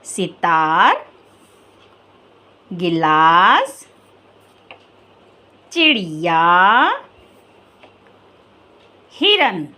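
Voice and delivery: low, slow recap of the words; teacher reviewing the word list slowly. Shown as a demonstration. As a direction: read the four words slowly, one by one. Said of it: "आइए, कुछ और शब्द पढ़ लेते हैं".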